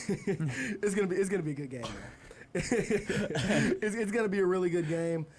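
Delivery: breaking into laughter mid-sentence; laughing. Real, not acted. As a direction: keep restarting through real chuckles. Host cracks up mid-sentence.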